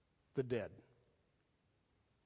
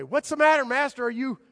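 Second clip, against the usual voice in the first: much higher, louder frantic plea; alarmed disciples; 8 semitones higher, 6 dB louder than usual. Retold or imitated. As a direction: cry out in mock panic. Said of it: "the disciples say".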